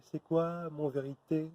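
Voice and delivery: high, slow, incredulous demand; indignant challenge. Acted. Confronting the one who mocks his dream, high and slow. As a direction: throw the question back in their face.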